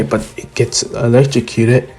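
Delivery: ordinary delivery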